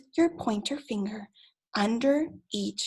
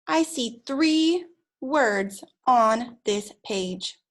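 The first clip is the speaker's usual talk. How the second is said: slow teacher emphasis; much slower than usual. Shown as a demonstration. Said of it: "teacher models slow counting".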